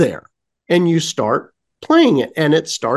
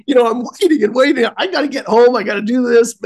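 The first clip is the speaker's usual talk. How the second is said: impatient; 6 semitones higher, faster than usual. Retold or imitated. Retells impatient wait for elevator.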